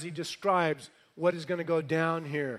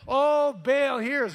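high, loud mock plea; imploring cry; 8 semitones higher, 6 dB louder, slower than usual. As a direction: imitate a worshipper calling out. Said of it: "Can you imagine?".

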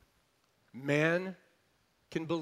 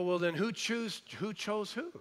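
puzzled listener; 7 semitones higher than usual. Retold or imitated. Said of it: "You go".

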